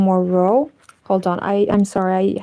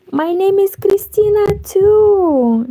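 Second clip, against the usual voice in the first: excited; 9 semitones higher, 3 dB louder than usual. Real, not acted. Surprised shared name.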